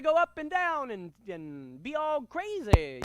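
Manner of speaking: sing-song rise and fall, "crazy" drawn out; exaggerated voice inflection. Shown as a demonstration. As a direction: swoop high on "up and down", stretch "crazy". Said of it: "don't just keep your voice on one plane".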